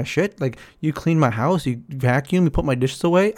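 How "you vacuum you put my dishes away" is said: ordinary talk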